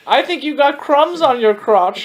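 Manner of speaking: put-on high-pitched, cloyingly helpful old lady act; overly kind lady. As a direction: play a meddling, too-kind old lady. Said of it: "The overly kind lady".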